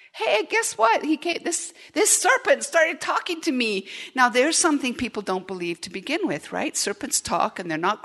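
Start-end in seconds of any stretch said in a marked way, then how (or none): 0.1-3.9 high, bubbly wife voice; excited Eve. Pretend. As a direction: gush the news like a giddy girl. Eve excited telling Adam.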